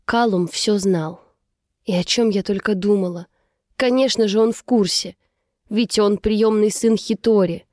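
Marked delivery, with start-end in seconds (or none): none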